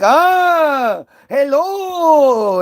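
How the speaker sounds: high, drawn-out caricature greeting; hammed-up goofy foreigner voice. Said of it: "as goofy stereotype".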